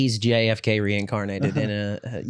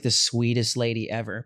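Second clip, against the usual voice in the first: ordinary talk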